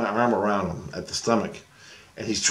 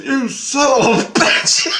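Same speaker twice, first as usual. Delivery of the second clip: high, loud, drawn-out cussing; stretched-out comic indignation; laughing mock outrage; 6 dB louder, slower than usual. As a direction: curse him out while cracking up.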